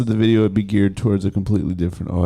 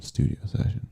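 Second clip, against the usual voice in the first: whispering; 4 semitones higher, 7 dB quieter than usual. Real, not acted. Whispered aside.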